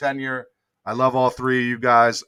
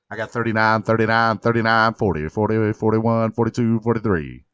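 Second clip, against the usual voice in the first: auctioneer; 4 semitones lower than usual. Pretend. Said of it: "I probably could be like a an auctioneer".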